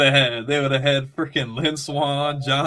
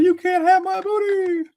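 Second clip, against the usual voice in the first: much higher, louder put-on voice; mock scared victim; 12 semitones higher, 4 dB louder than usual. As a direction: squeal it like a frightened victim. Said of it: "He's like".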